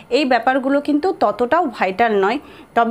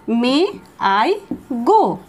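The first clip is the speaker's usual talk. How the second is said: spaced-out words; much slower than usual. Shown as a demonstration. Teacher telling students how to ask permission, slow drill. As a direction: say it one word at a time. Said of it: "তাহলে বলো".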